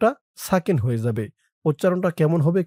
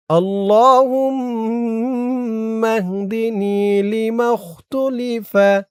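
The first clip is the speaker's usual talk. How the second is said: drawn-out, chanted Arabic recitation, higher and slow; slow dua recitation; 5 semitones higher, much slower than usual. Shown as a demonstration. Teacher reciting the dua for students, slow chant. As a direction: intone each word of the dua slowly.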